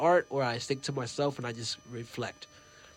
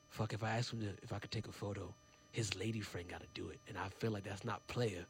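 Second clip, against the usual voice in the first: much softer, hushed private musing; inner thought; 7 semitones lower, 15 dB quieter than usual. Retold or imitated. Fan debating whether to ask for a photo, soft hushed thinking. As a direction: think it to yourself under your breath.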